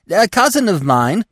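high, loud squirrel interjection; insistent high-pitched interruption; cutting in. A narrator's reading. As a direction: cut in eagerly over the others. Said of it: "The squirrel's voice cut in".

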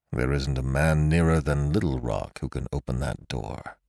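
put-on low, soft trembling old man; shaky voice. A narrator's reading. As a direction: let the voice tremble with dread. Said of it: "in a shaky voice".

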